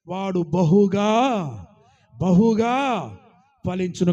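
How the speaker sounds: loud, drawn-out proclamation of బహుగా; slow booming pulpit emphasis; forceful preaching. Acted. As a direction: cry బహుగా out slowly and loudly, twice. Preacher hammers key verse.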